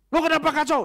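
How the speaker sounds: high, loud, frantic fretting over disorder; exasperated complaint. Acted. A control-obsessed man fretting over Indonesia, exasperated and high. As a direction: rant like everything is falling apart.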